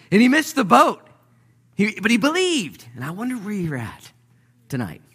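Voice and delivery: loud, high, driving sermon delivery; full-force preaching. Acted. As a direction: preach it out, building fast.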